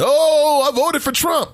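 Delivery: exaggerated high-pitched mock boast; mocking imitation. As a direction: wail it like a self-important voter being ridiculed. Mocking voters who think it matters.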